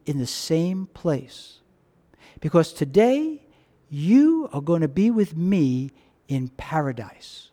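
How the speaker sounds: slow, soft, low promise; tender promise. Acted. Jesus to the dying thief on the cross, slow and tender. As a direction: promise paradise slowly and gently.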